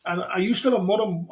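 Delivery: ordinary delivery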